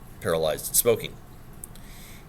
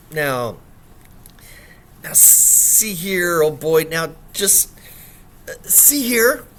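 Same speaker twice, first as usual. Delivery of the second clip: halting, higher flustered muttering; flustered mumbling; 4 semitones higher, 4 dB louder, much slower than usual. Acted. Gentleman muttering to himself after the accusation, halting and flustered. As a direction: stammer it out to himself, rattled. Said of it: "mumbled to himself".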